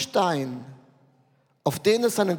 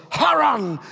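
Haran! called out high and loud; bright shouted Haran; excited exclaim; 3 semitones higher, 5 dB louder than usual. Shown as a demonstration. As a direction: make Haran sound thrilling. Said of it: "hört sich nicht spannend an".

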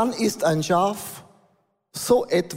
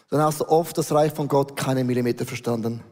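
normal talking voice

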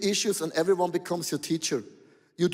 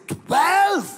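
much higher, louder shocked "Twelve?"; shocked exclamation; 10 semitones higher, 6 dB louder than usual. Retold or imitated. Pastor reacting to caller's twelve beers, shouting in disbelief. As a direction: blurt it out in disbelief.

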